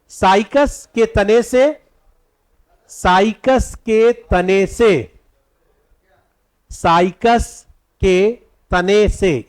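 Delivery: very slow, word-by-word dictation. Shown as a demonstration. Teacher dictating the first point to students, slow dictation. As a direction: dictate slowly with gaps for writing. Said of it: "अब पहला point लिखिएगा".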